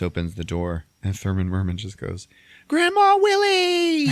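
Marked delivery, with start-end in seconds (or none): 2.7-4.1 loud, very high drawn-out squeal; overjoyed greeting. Retold or imitated. Kid greeting grandma at the door, shrieking with joy. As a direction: shout it gleefully, stretching the words.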